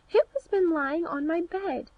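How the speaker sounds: a lightly voiced dwarf crying out in surprise; cried out. A narrator's reading. Dwarf finding a hollow in his bed, startled outcry. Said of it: "and cried".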